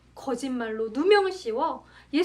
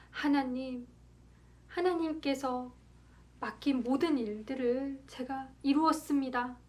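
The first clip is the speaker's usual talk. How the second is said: soft, subdued dying prayer; hushed last words; 6 dB quieter than usual. Acted. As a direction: say it softly, as last words to God. Dying Jesus speaks his last words. (마지막 말처럼 나직하게)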